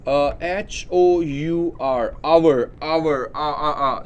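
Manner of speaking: slow h-o-u-r, then आवर with a silent h; spelling and sounding hour. Shown as a demonstration. Teacher to students, sounding out hour. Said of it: "यहाँ पे एन लगेगा".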